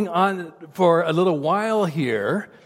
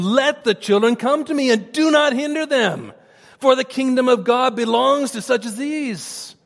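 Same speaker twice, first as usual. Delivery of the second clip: higher, firm indignant rebuke; indignant Jesus; 6 semitones higher than usual. Retold or imitated. Jesus rebuking his disciples for hindering children, indignant and firm. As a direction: set the disciples straight with an edge. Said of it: "He was upset".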